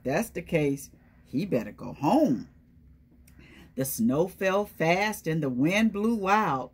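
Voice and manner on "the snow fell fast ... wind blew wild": ordinary delivery, nothing marked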